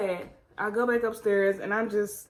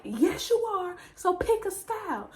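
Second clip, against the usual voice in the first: higher, firm mom voice; mom insisting; 8 semitones higher than usual. Retold or imitated.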